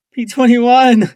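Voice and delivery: screamed high and loud, panicked; desperate scream. A narrator's reading. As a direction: scream his name to wake him. Desperate cry over lifeless friend.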